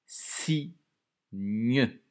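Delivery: "signe" loud, pronounced "si-gne" with the mute e sounded; loud, syllabified "signe"; syllables voiced out. Shown as a demonstration. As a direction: sound out "signe" with the final e audible. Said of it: "avec une lettre muette à la fin".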